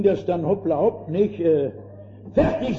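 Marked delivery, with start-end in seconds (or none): 2.3-2.8 put-on eager boy. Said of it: "sagte ich".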